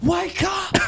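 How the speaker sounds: shouting. Pretend. Mock shouting at coma patient.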